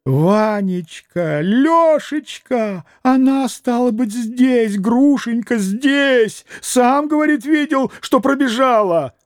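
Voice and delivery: put-on high, slow old man gasping out the news; choking old man. A narrator's reading. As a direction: sputter it out, beside himself. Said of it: "Он захлёбывался".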